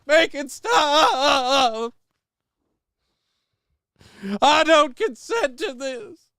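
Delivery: high, drawn-out mock wail; mock panicked scream. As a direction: ham up a terrified plea, stretched out. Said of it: "I was like".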